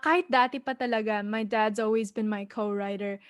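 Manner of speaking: ordinary talk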